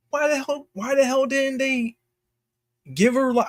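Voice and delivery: exasperated, can't believe they didn't fix it; incredulous outrage. Real, not acted. Streamer ranting to viewers about the too-heavy sword, incredulous and high. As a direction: pitch shoots way up in disbelief.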